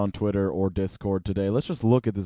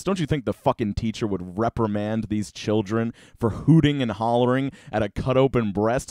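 normal talking voice

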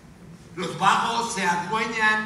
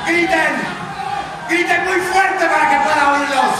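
loud, high exhortation; impassioned shouting; 5 semitones higher, 5 dB louder than usual. Acted. Anchor urging viewers to drown out chaos, impassioned shouting. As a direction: bellow over a roaring crowd.

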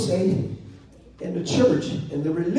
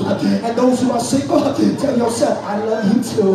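full-force sermon delivery, driving phrase by phrase; preaching; 6 semitones higher, 5 dB louder than usual. Real, not acted. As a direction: preach hard to the congregation, punching each phrase.

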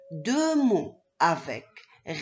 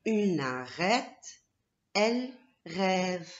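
"Une arête" read slowly, syllable by syllable; teacher reading "Une arête" to children slowly; slow reading aloud; slower than usual. Shown as a demonstration. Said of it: "je lis les deux affichettes".

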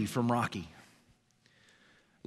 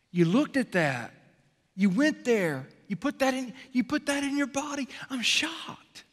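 higher, rising in mock outrage; mock shocked scolding; 10 semitones higher than usual. God imagined scolding a sinner, mock outrage. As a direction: climb higher with each accusation, hammed up. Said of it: "He's not going".

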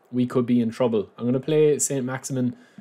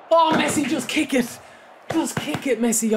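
frustrated plea; 13 semitones higher than usual. Real, not acted. Frustrated at player not shooting.

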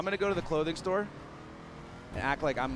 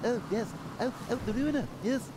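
a hint of a high cartoon baby voice, pitched higher; half-hearted cartoon toddler impression, higher; Stewie voice; 8 semitones higher than usual. Gamer calling the Ruiner car, lightly voicing Stewie. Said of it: "I can't even really do the Stewie voice".

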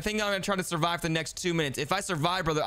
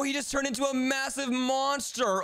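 normal talking voice

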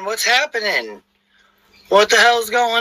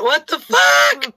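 high drawn-out disbelief; slow stunned what the fuck; surprised; 7 semitones higher, much slower than usual. Real, not acted. Shocked at mic trouble.

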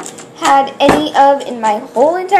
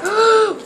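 gasp; 8 semitones higher than usual. Real, not acted. Unboxing surprise gasp.

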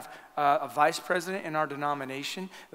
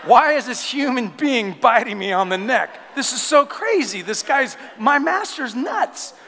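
higher and louder, frantic puppy inner voice; panicked dog's thoughts; 7 semitones higher, 6 dB louder than usual. Pretend. Puppy pinned by its owner, frantic high yell. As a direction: play the dog, shocked and yelling. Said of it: "It probably scarred him for life".

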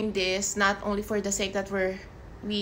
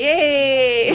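high, loud cheer; excited cheer; 5 semitones higher, 11 dB louder than usual. Real, not acted. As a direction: whoop with delight. Cheers the topic.

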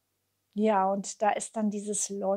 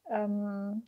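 ordinary delivery

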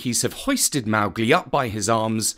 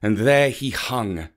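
plain reading voice

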